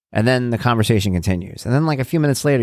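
ordinary talk

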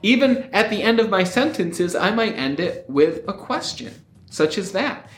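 upward lilt, "such as that" rising like a question; question-like ending. Shown as a demonstration. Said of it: "it sounded like I was because of the way that I ended it".

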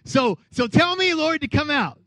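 high, eager retold Peter. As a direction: beg to join him on the water. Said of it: "He's like".

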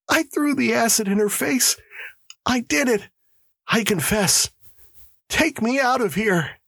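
high-pitched, desperate confession; screamed confession. Acted. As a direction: plead in a high, panicked voice. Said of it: "he screamed".

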